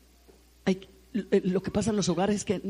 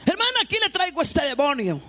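higher, louder complaining mother; indignant mother; 8 semitones higher, 5 dB louder than usual. Retold or imitated. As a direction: hand over the child like a burden, loudly. Angry mother bringing her daughter. (madre indignada, voz alta)

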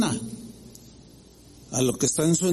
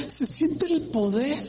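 high and slow, fervent; ecstatic believer; 9 semitones higher than usual. Retold or imitated. Pastor mimicking the believer once more. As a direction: savour the power, pitched high. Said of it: "Así".

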